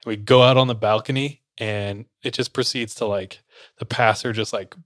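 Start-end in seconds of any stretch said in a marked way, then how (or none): none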